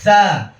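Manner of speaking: "সা" forced with a hard, tense consonant; over-strong "সা" as a mistake; hard wrong tha. Shown as a demonstration. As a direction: press the start of "সা" too strongly. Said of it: "অনেকে শক্ত করে বলি".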